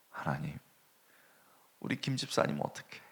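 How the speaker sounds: soft, hushed tearful plea to God; crying prayer. Retold or imitated. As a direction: murmur it like a quiet weeping prayer. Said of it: "막 우는 거예요".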